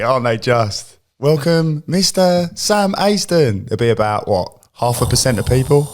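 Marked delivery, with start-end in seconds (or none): 1.2-3.6 high, drawn-out mock announcer's introduction; mock event announcer. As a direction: imitate an MC grandly introducing a celebrity. Mock announcing the lights switch-on guest.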